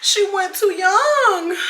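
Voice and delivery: high, slow fake wail over a broken highlighter; hammed-up mourning with a cry in the voice; mourning a highlighter like a lost friend, wailing high; mock grief.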